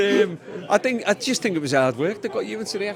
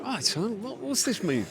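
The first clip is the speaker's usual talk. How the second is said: put-on higher, softer [name] accent; 5 semitones higher, 8 dB quieter, slower than usual. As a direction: put on a quiet, high-pitched Bowie-style accent. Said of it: "that's [name] accent".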